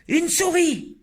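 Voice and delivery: high, loud cry of disgust; a cook shrieking at a mouse; alarmed shout. Acted. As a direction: yell it at the mouse in horror. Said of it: "criait-il".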